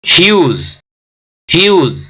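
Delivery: ह्यूज with a long यू; long-u huge. Shown as a demonstration. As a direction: draw out the यू in ह्यूज. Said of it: "लंबा खींच गया".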